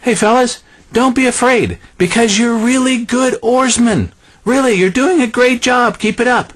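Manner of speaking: high, chirpy pep talk; mock cheerleader Jesus. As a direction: hype up the rowers like a coach. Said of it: "Notice he didn't say".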